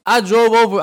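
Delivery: ordinary talk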